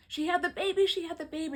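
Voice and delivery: high, loud excited blurt; father bursting in with news, high and excited; excited shout. Retold or imitated. Father announcing birth, excited.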